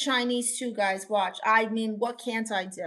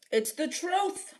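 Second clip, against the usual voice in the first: high mock-innocent "It's the truth." with a rising end; "truth" lifted up in a put-on voice; sing-song "It's the truth" as a cheeky protest; laying it on thick; 6 semitones higher than usual. Shown as a demonstration. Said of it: "Now she's just laying it on thick".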